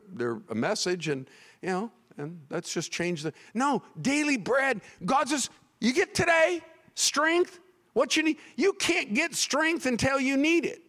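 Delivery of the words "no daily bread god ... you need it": high, emphatic preacher's cry; forceful preaching. Real, not acted. Preacher correcting the congregation on daily bread, forceful. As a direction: preach it hard, pitched way up.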